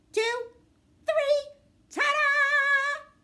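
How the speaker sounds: very high, loud, drawn-out reveal; magician's big reveal; triumphant ta-da. Acted. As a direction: stretch it like a magic finale.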